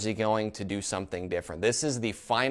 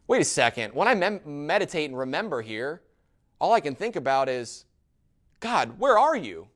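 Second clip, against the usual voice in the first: distressed questioning; 5 semitones higher, 4 dB louder than usual. Acted. Psalmist calling out to an absent God, distressed questioning. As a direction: act out the psalmist's bewildered appeal to God.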